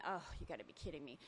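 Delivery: quiet, fast muttered thought; inner thought. Retold or imitated. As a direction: mutter it under your breath, quickly. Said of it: "and I'm thinking".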